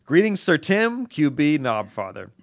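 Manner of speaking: reading a listener letter in a mock grand tone; mock grand greeting. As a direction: read it out slow like a herald.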